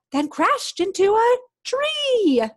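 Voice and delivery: gasp, then slow and loud on the crash. Acted. Reader to children, Zog hits the tree with a gasp. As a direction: play the crash big for the kids.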